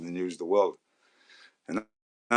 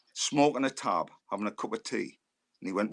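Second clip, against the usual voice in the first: ordinary talk, nothing marked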